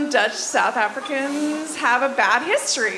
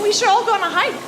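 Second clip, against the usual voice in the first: higher, louder and quick, acting keen; eager suggestion; 9 semitones higher, 5 dB louder, faster than usual. Doctor friend pitches a group hike, eager imitation. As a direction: imitate a friend pushing a plan.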